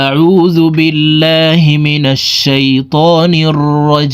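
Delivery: slow, sustained melodic Arabic recitation; Quranic recitation. Shown as a demonstration. Teacher opening the Quran lesson for viewers, slow recitation. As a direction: draw out the long vowels in a measured chant.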